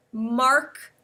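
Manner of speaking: ordinary talk, nothing marked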